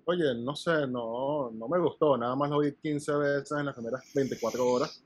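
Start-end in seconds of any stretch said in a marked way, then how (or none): none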